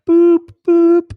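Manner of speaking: high, loud hooted phone ring; British ringtone imitation. Caller describing WhatsApp's ring to the livestream, high imitated ringtone. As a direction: mimic a British ringing tone. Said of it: "it does the British ring".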